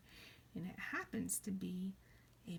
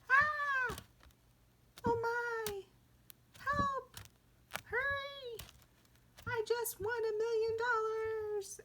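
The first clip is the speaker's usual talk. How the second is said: very high, squealing excitement; excited exclaiming; 5 dB louder, slower than usual. Shown as a demonstration. Teacher shows exclamation point, excited exclaiming. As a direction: exclaim as if thrilled, voice way up. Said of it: "It shows excitement".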